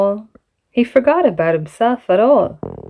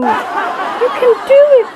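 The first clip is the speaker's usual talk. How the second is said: cheering cry. Acted. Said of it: "cried Samson".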